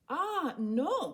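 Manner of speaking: a hint of a boy pleading; lightly voiced boy. A narrator's reading. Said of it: "Condi said".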